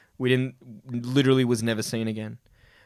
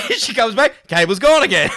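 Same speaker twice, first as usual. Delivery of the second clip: excited; 7 semitones higher, 6 dB louder than usual. Real, not acted. Laughing climax of prank story.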